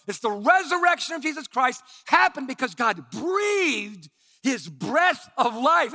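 loud, high, shouting sermon; full-force preaching. Acted. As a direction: preach it out with fire.

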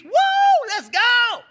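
high, loud whoop of joy; elated whoop. Acted. Forgiven servant skipping out elated, whooping. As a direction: celebrate skipping in the streets. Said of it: "he's elated".

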